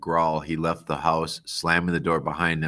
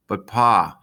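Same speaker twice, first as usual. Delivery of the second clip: higher, louder whiny protest; protesting girl; 4 semitones higher, 6 dB louder than usual. Acted. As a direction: push back at Pa loudly.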